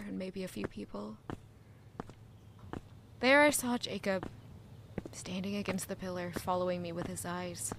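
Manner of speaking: soft, low story narration; hushed narration. A narrator's reading. Narrator setting the morning street scene, hushed. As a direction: tell it quietly, tired.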